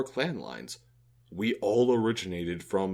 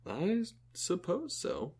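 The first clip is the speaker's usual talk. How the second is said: slow, higher, softer half-agreement; unconvinced; 8 semitones higher, 5 dB quieter than usual. Acted. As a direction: agree reluctantly, drawing it out doubtfully. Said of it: "you don't sound all that convinced".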